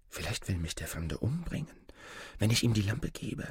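hushed, wary inner voice; uneasy thought. A narrator's reading. Boy suspects the stranger's intent, hushed and uneasy. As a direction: think it softly, with creeping unease. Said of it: "wurde es dem Jungen unheimlich".

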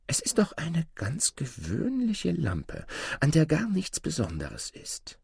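high, soft, half-whispered mother voice; puzzled mother. Acted. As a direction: muse softly up high as the mother. Mother puzzles over the lamp. (hohe, leise Mutterstimme)

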